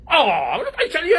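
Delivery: high mocking imitation of the doctor; doctor's retort. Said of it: "قال لي". (تقليد ساخر للطبيب بصوت عالي النبرة)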